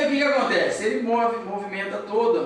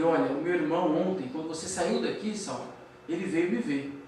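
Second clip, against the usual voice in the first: low, subdued retold deathbed words; hushed, lightly acted farewell; dying woman; 5 semitones lower, 5 dB quieter than usual. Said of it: "ela está realmente nos momentos finais".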